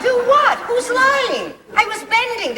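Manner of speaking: high, flustered surprise; startled. Acted. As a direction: caught off guard, jumpy. Startled by sudden shout.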